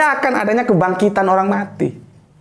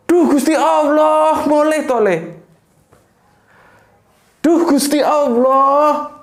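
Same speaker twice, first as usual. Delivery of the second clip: slow, higher, drawn-out exclamations of shock; startled Javanese mother; 7 semitones higher, slower than usual. Retold or imitated. Mother sweeping the yard sees her son arrive, startled cry. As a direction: cry out to God in disbelief, stretching each word. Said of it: "Ekspresinya seperti itu".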